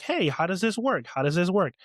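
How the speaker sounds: ordinary talk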